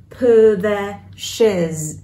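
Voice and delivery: "put the shiz" very slowly joined; "put the shiz" drawn out, t swallowed into "the"; linked words. Shown as a demonstration. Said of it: "đọc nối nha".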